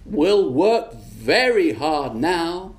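very slow, knowing; slow witch prophecy. Acted. Witch promising the foreman, very slow. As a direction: draw it out like a spell. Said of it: "she said".

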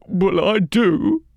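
put-on high, loud, tearful old crone; weepy old woman. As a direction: cry it out like a grieving old woman.

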